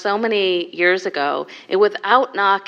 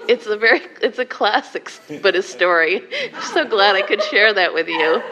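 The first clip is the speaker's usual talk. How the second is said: laughing afterglow; 2 semitones higher than usual. Real, not acted.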